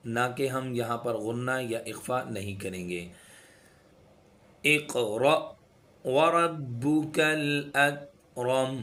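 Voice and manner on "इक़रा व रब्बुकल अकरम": slow, melodic tajweed chant; Quran recitation. Shown as a demonstration. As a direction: recite slowly, with each sound drawn out.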